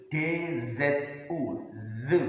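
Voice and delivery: "dzu" said with soft dz, letter by letter; spelling dzu. Shown as a demonstration. Teacher spelling sounds for pupils, careful dzu.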